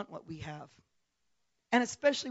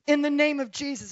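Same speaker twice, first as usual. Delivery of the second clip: raised, commanding prayer; retold bold prayer; 5 semitones higher, 7 dB louder than usual. Retelling praying over an unconscious woman, bold prayer. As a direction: pray it out loud with authority. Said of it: "just began to pray".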